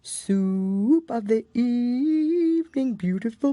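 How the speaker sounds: slow, sung, fading far off; mournful singing from afar; faint distant song. Acted. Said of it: "more and more faintly came".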